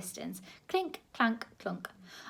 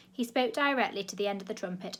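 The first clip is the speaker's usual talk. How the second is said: ordinary delivery, nothing marked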